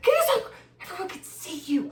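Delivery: mock child pleading; embarrassed kid.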